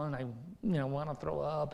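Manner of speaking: ordinary talk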